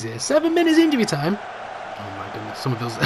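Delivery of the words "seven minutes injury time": surprised. Real, not acted. Shocked at added time.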